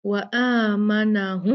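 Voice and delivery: आमनहुम slow with a humming nasal मीम; slow wa-aamana-humm; strong ghunna. Shown as a demonstration. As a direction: hold the m in आमनहुम with a nasal hum. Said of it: "बहुत अच्छा गुन्ना करेंगे".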